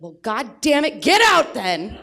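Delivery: put-on defiant child yells at the adult; kid yelling back. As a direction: shout the kid's indignant comeback. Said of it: "And he'd be like".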